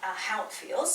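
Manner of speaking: ordinary talk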